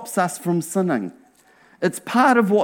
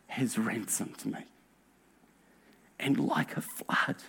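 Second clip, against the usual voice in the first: hushed, much softer prayerful whisper; worshipful whisper; 8 semitones lower, 16 dB quieter than usual. Real, not acted. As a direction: whisper it as if praying alone. Worshipful hush after song lyrics.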